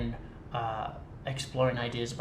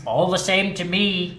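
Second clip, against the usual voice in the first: put-on loud, higher dismissive old miser; Scrooge; 6 semitones higher, 8 dB louder than usual. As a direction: brush it off like a bitter old man. Scrooge voiced by [name].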